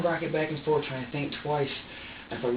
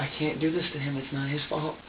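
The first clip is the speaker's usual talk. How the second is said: usual speaking voice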